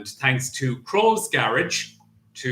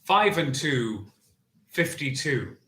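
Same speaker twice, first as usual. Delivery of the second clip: bingo caller; 3 semitones higher than usual. Acted. Bingo number calling.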